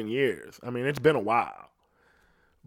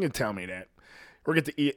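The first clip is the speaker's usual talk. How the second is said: ordinary delivery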